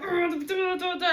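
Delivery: high, loud babbled rant sounds; mock ranting noises. Mum in the car, spluttering at her son in wordless rage, high and loud. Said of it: "I'm like".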